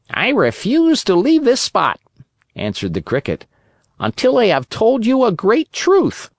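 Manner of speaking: high, lightly voiced old cricket; talking cricket voice.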